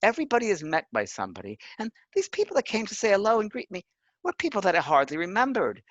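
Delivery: a hint of the late friend marvelling; friend's voice. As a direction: recount her awe, voice lifted high. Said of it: "And she said".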